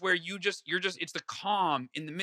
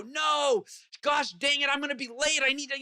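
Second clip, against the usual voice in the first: mock traffic frustration; 8 semitones higher than usual. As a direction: play your old self fuming at the traffic, a bit hammed-up. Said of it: "I'd get all upset".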